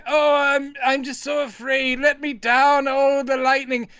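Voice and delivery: high hammed-up whimper of fear; mock terrified old man. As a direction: overplay the old man's fright. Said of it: "he's sitting there going".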